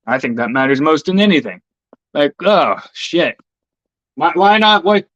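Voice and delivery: ordinary delivery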